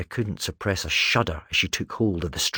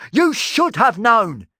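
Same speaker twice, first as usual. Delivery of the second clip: high, loud, slow accusing yell; 7 dB louder, slower than usual. Acted. Talon yelling at Erasmus for not knowing. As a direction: lean into every word in anger. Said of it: "Talon yelled".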